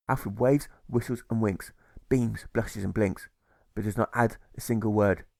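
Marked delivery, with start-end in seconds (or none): none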